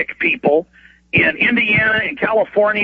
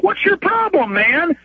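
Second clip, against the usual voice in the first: high indignant mock protest; retold protest; 10 semitones higher, slower than usual. EMT protesting to the cops, high indignant mock. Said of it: "the guy's like".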